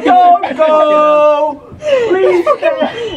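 mock plea. Joking plea in the lift.

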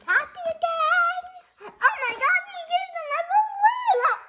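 excited squeal. Real, not acted. Delight at the happy ending.

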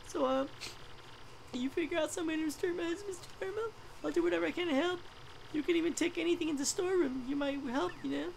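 high, whispered tearful storytelling; a heartbroken wife, half-whispering through tears; crying widow. Acted. Widow tells traveler about husband, high whispered crying. Widow NPC asks for help.

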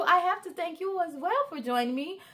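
usual speaking voice

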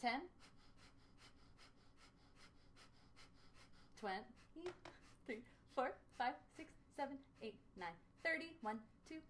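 counting through quick nose puffs. Real, not acted. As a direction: count softly between sharp nose breaths. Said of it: "Here we go, nose puffs".

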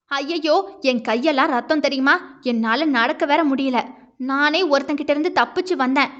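usual speaking voice

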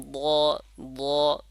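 short, full "ض" cut off after the vowel; letter sound demo. Shown as a demonstration. Teacher isolates the letter from the verse, sound demo. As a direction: say "ض" as an isolated, rounded syllable. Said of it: "যেমন পড়ি না".

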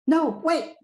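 high, loud alarmed cry; panicked cry. Acted. Panicked cry as music starts.

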